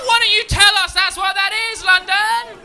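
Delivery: very high, very loud screamed complaint; shrieked comic outrage, far above normal pitch; outraged scream. Acted. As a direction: scream it at the whole city in outrage. Mock outrage after screamed fox impression.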